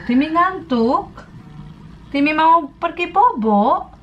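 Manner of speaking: put-on sing-song baby talk to a dog; squeaky praising a dog. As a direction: coo to a sleepy puppy.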